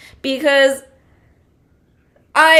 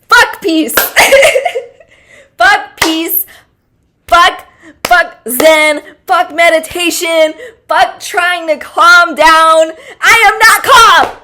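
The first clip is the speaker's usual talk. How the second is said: high, loud, fed-up outburst against calm; mock furious rant; 6 semitones higher, 9 dB louder, slower than usual. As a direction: yell it like you've snapped, half joking. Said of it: "outburst of fed-up rant".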